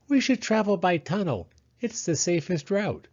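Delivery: high, eager mole with a plan; Mole's voice. Acted. Mole telling Hedgehog the plan to visit Owl, high and eager. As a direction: announce the plan brightly as Mole.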